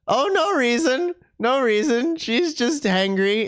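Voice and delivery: sing-song no reason; playful innocence. Acted.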